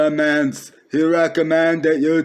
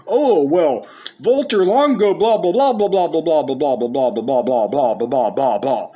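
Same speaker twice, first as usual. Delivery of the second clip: mocking commenter. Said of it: "dude commenting on my video says".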